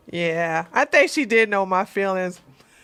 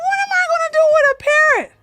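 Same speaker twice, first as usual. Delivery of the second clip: much higher, fast, disbelieving; incredulous; 5 dB louder, faster than usual. Retold or imitated. Told she inherited a parrot, high, fast disbelief. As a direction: squeak it out in disbelief. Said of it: "I was like".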